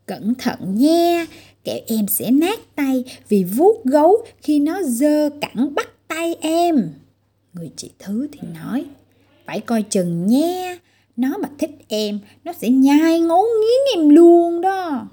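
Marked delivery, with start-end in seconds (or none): 0.1-6.9 slow, teasing warning, pitch climbing at the end; sneering older sister, drawn out; mocking sister. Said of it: "dè biểu, giễu cợt". 9.4-15.1 slow taunt swelling to a loud, high mock threat; jeering older sister, louder at the end. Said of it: "dè biểu, giễu cợt".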